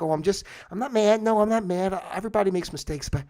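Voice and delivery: high, sugary mock calm; passive-aggressive. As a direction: pretend it's fine, sweetly. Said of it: "nice passive aggressive way".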